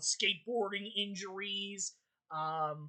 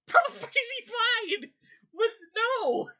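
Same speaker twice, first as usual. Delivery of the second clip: much higher, louder cries of disbelief; real exasperation; 13 semitones higher, 4 dB louder than usual. Real, not acted. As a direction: yelp it in total disbelief. Outburst at the plot.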